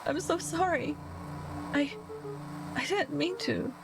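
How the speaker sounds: guilty. Acted. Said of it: "overwhelmed by guilt".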